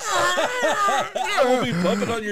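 laughing loud shout. Real, not acted. Laughing at heart attack joke.

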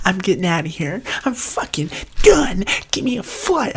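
high, loud mock rage, slowing on done; mock furious exit. Friend mimicking a contestant quitting the show, high mock tantrum. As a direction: storm off in a high huff.

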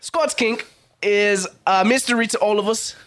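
put-on British accent sliding into Australian; badly faked British, half Australian; attempted English accent. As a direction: try English and land in Australian. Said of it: "You're still Australian".

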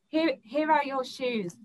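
a hint of a matter-of-fact man; casual assailant. Attacker handing her shoes in the hall, lightly voiced offhand.

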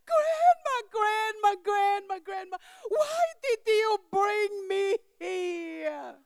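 imitating crying child. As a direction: wail it like a frightened ten-year-old. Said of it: "start crying at the same time".

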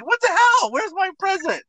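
put-on squeaky childish complaint; little kid. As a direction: demand the present like a spoiled little kid. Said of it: "I'm like a little kid".

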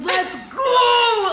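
high, loud hammed-up yell breaking into laughter; mock celebration shout. Imitating men celebrating goals for the co-host, mock triumphant shout. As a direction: holler it like a showboating scorer. Mimicking a men's goal celebration.

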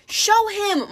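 high, eager female snake plea; pleading. A narrator's reading. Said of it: "Martha pleaded".

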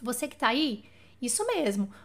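usual speaking voice

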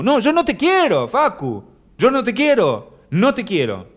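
high, loud playful "no te quiero"; mock rejection. Host teasing Facu who said he loves him, high mock refusal. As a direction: tease him with a shrill fake brush-off.

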